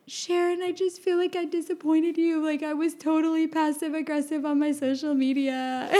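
a hint of retold tears; mock tearful apology. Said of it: "I was sobbing through the whole conversation".

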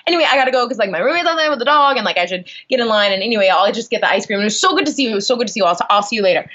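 rushed, breathless-sounding run-on chatter; frantic over-friendly retold small talk; manic rambling. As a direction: babble excuses to escape an awkward encounter. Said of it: "like, manic".

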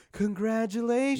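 high, drawn-out mock congratulations; gushing well-wisher imitation. Fiance imitates people hearing of the engagement, mock gushing. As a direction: sing it out like an overexcited well-wisher.

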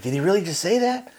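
high-pitched mock disbelief; incredulous. Crew member reacts to Prin cursing the captain, incredulous.

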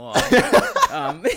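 loud laughing tease; bursting into laughter. Real, not acted. Teasing co-host.

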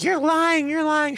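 put-on very high-pitched children's taunt; mimicked accusing kids. Kids chant accusation at the liar.

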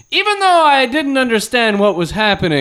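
put-on high, earnest kid reviewer voice; mock teen critic. Mocking the teen reviewer's review in a high kid voice. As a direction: pretend to be the smug young reviewer. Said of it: "it's like".

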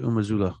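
Umazulo as 'oo-ma-ZOO-luh', falling at the end; correct name pronunciation. Shown as a demonstration. Commentator repeating the recruit's name for listeners, careful pronunciation. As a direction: say Umazulo with a relaxed 'luh' ending. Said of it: "That's how he wants you to pronounce it".

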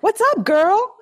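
high, bright mock greeting from the client; imitating client's greeting. Client calling her on the phone, mock high greeting. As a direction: mimic the client's chirpy hello. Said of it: "she'd be like".

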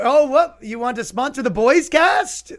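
high, loud, eager pretend surprise; mock surprised phone call. Faking a big phone call, high and loud surprise. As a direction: gush into a fake phone.

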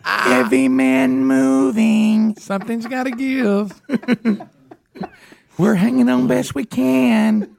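announcer voice. Pretend. Mock dramatic caption voice.

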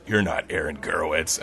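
low-pitched imitation of a challenging man; deadpan low male challenge; accusing man. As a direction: state it low, without raising the voice. Man challenges him in bathroom.

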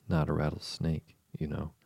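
usual speaking voice

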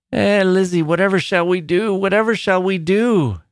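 a peevish grandmother wringing her hands in dread; old woman crying out. A narrator's reading. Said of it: "she cried".

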